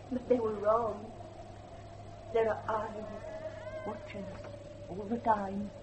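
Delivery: put-on girl warning the guest that the house is watching, hushed and fearful; eerie hush. Girl warns of watching eyes.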